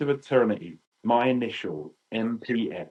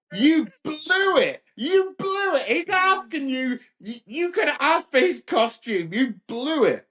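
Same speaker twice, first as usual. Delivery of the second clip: very high, strained mock wail; retold seething outburst. Teenager rages at his little brother for wasting his chance, high seething wail. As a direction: squeal it in hammed-up outrage, dragging the words. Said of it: "I'm seething. I'm like".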